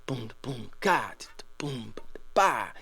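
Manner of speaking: beatbox the two four groove under your breath; vocal drum beat. Shown as a demonstration. Teacher to student, beatboxing a soft two four beat. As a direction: boom and ga mouthed softly like kick and snare. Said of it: "the beat is something like".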